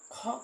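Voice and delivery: "खा" with the R of car left off; silent R. Shown as a demonstration. Teacher repeating British car for students, "खा" with silent R. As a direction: say car as "खा", open vowel, no R at the end. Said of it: "आर को कहे गायब हो जाओ".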